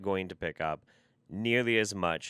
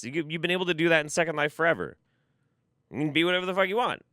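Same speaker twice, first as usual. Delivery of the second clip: ordinary delivery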